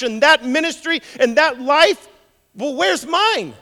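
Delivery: high, loud cry of complaint; aggrieved pleading. Acted. Believer asking God for their share, aggrieved. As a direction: demand an answer, building a list of grievances.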